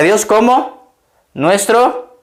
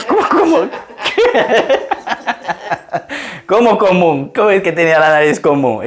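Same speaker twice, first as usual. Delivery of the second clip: amused disbelief; 2 semitones higher than usual. Retold or imitated. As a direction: act out your own baffled surprise, laughing. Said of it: "yo le veía a la mujer y dije". (asombro incrédulo entre risas)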